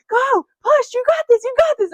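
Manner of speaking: high, loud cheering; imitating husband yelling. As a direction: shout encouragement right in her ear. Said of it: "he, like, starts yelling".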